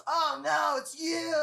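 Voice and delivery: high, shouted dismay. Retold or imitated. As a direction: recoil at the sight of him. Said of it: "then she goes".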